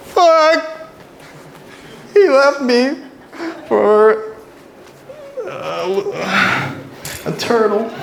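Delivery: high, hammed-up sobbing model wail; mock emotional model. Stand-up comic acting a dumped model on the catwalk, hammed-up high wail. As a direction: wail it like a heartbroken runway model. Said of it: "go like this".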